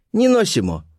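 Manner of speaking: put-on high-pitched foreign girl repeating herself; Colibri's voice. A narrator's reading. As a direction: repeat it high, with a firm fall. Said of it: "повторила Колибри".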